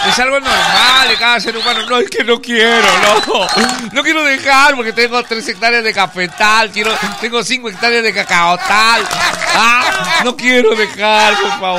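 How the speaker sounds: high, loud pleading; pleading farmer. Acted. Acted protest against dying.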